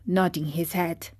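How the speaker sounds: usual speaking voice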